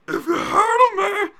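high, slow screaming mock of a fighter; imitating Devin Clark. Host imitating the fighter yelling between rounds. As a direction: shriek it slowly like a wild fighter between rounds. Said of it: "where he's just like".

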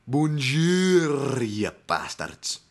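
high, loud cartoon Scottish greeting, drawn out then dropping; Willie from The Simpsons. Fan imitating Willie for podcast listeners, hammed-up Scottish bellow. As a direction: bellow it in a broad Scottish accent. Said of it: "he reminds me of Willie".

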